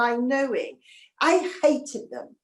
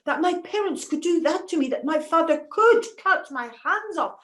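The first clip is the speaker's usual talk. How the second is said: usual speaking voice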